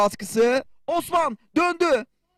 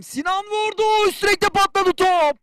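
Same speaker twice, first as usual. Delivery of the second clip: excited commentator; 7 semitones higher, 3 dB louder than usual. Real, not acted. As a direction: cry out at the near miss. Shot hits the crossbar.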